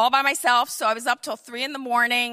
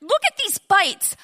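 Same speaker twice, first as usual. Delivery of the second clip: alarmed daughter; 7 semitones higher, 7 dB louder than usual. Retold or imitated. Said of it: "she was like".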